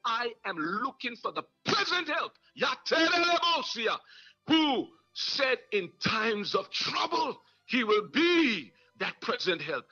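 rising, fervent sermon cadence; preaching. Acted. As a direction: preach it to the congregation with fire. Preaching at full force.

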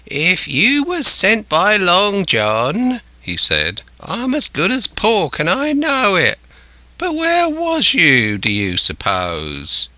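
high, slow, wailing castaway voice; castaway, alarmed. A narrator's reading. Castaway fearing Jim was sent by Long John, alarmed. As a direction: pitch it up high, then drop at the end.

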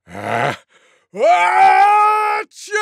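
long squealing achoo burst; enormous mock sneeze. A narrator's reading. Tiger throws her head back, enormous mock sneeze. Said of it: "gave the loudest sneeze".

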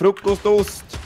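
high, slow stretched FRUKOSTOST; drawn-out echo. Pretend. Echoing a teammate's silly word, high and drawn out. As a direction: draw out FRUKOSTOST slowly in a high voice.

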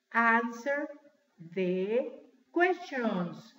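very slow, pauses between words. Shown as a demonstration. Teacher asking class to repeat, word by word. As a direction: model it slowly for repetition. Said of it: "Tres palabras separadas".

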